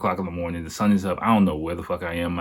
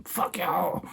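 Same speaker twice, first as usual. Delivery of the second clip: mock yell; 11 semitones higher, 6 dB quieter than usual. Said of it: "yelling at the top of my lungs at them".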